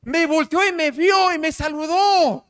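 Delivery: high, loud breathless excitement; bursting with the news; thrilled son. Retold or imitated. Son phoning his dad about the parade, excited and high. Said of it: "me llama y dice".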